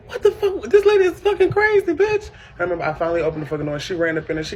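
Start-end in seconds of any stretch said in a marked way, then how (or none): 0.1-2.3 high, incredulous cursing; disbelieving outburst. Retold or imitated. Teen daughter reacting to mother breaking down her door, high and outraged. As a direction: react like she's lost her mind. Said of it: "I'm like".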